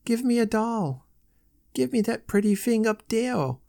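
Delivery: put-on high little child's voice; smaller child voice. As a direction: speak as a tiny eager child. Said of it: "varied by smaller voices saying".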